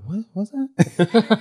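high, slow confused heckle; baffled audience member. Retold or imitated. Audience failing to understand him on stage, baffled. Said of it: "I hear people, like, saying".

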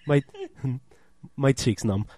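low, soft tipsy mumbling through chuckles; low-pitched, giggly drunk impression; imitated drunk friend. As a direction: play a dazed drunk, quietly amused. Imitates drunk friend slapping numb face.

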